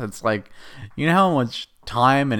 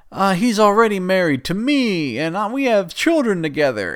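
higher, lightly voiced sheepish woman; mock Natalia; 8 semitones higher, 3 dB louder than usual. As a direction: play her a touch higher and meek. Natalia voiced in retold scene.

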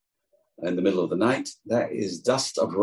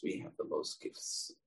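much softer, a breathy whisper; whispered repeat; 16 dB quieter than usual. Shown as a demonstration. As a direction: whisper it like a secret. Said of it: "said first aloud".

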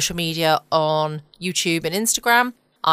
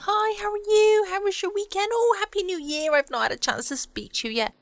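much higher, sing-song colleague greeting; mock chirpy small talk. Mocking colleagues' meeting small talk to listeners, high sing-song. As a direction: parody a too-cheery meeting opener. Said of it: "do all the niceties at the start of a meeting".

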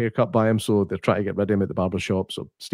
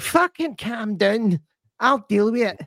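high, slow mock Glasgow mum; mum character; 11 semitones higher, slower than usual. Imitating the killer mum telling her son to calm down. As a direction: pitch it up and drawl it out. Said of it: "she's just like".

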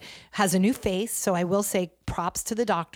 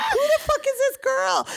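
high, incredulous, laughing through it; laughing disbelief; 4 dB louder than usual. Retold or imitated. Retelling an Instagram DM from Anna to a cohost, laughing disbelief. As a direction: squeal it out in mock shock, laughing. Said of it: "And I was like".

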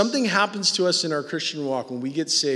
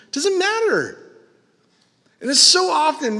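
loud, high preaching at full force; 12 semitones higher, 7 dB louder than usual. Acted. Preacher to congregation about armchair critics, loud and high. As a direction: hammer it home from the pulpit.

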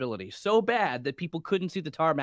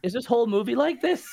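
laughing; 7 semitones higher, 4 dB louder than usual. Retold or imitated. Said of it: "And I'm like".

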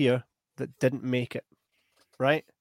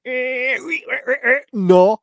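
high stammering mock hesitation, then a louder no; mocking the psychic; 11 semitones higher, 9 dB louder, slower than usual. The psychic, imitated as dithering before a loud no. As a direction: imitate a dithering psychic who finally says no. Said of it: "And he's like".

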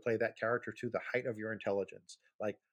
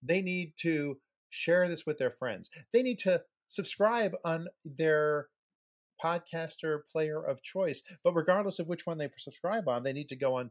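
higher, slow, emphatic YouTuber pitch; hyped channel plug; 5 semitones higher, 3 dB louder than usual. Pretend. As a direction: sell the subscribe button hard. Hyped call to subscribe.

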